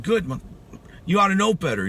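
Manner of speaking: sharp, high, loud scolding; freaking mad. Retold or imitated. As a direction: throw it back at him as a rebuke. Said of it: "it made me so freaking mad".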